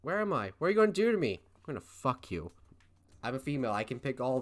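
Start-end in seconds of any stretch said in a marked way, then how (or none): 0.0-1.4 put-on higher, louder panicky girl; frightened girl voice. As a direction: play the scared captive. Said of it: "I'll go with female voice".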